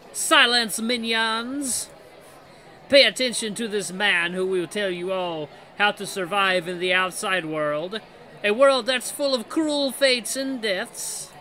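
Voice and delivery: French Mickey. Acted. Mickey silencing his minions, commanding fake French accent. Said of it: "here's my French Mickey".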